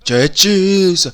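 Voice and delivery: loud, slow, high drawn-out shout; slow loud cry. Acted. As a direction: drag it out in a big shout. Battle exclamation.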